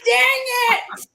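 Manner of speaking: high, loud "dang it" of mock dismay; dismayed cry. Real, not acted. Friend reacting to the split books with a playful yelp.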